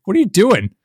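retold baffled protest; exasperated disbelief. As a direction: demand it in mock outrage. Acts exasperated reaction to cop.

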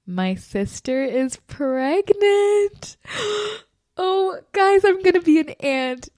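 high, loud squeal of joy; thrilled announcement. Real, not acted. Host tells listeners she'll be an aunt, thrilled. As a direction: stretch the reveal out, gasp, then burst. Host reveals sister's pregnancy.

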